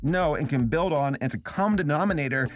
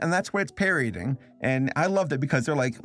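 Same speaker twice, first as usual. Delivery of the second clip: normal talking voice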